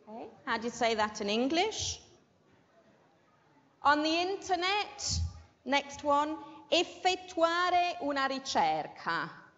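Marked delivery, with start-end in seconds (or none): none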